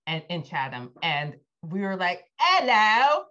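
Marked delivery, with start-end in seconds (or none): 2.4-3.2 loud, high mock-British greeting; hammed-up British hello, showing off; obnoxious British accent. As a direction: call it out in an over-the-top British accent. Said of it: "like, so obnoxious".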